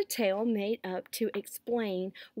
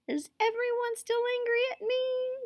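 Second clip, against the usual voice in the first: very high whiny insect voice; high-pitched, plaintive little bug; whining mosquito. A narrator's reading. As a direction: whine it like a guilty mosquito. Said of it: "whining in people's ears".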